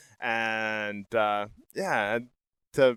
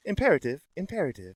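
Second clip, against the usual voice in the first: repeated machine-like announcement; computer voice; 4 semitones higher, faster than usual. Shown as a demonstration. Host showing the voice actor, computer voice. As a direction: imitate a talking computer. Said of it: "My computer voice?".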